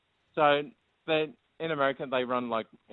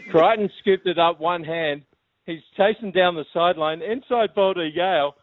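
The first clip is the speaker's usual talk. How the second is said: higher, excited play-by-play call; mock rugby commentary; 7 semitones higher, 4 dB louder than usual. Caller pretending to call the grand final, excited commentary. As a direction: call the break as it builds. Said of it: "call us the dying stages of the grand final".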